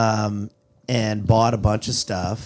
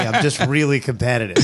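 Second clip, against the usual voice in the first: normal talking voice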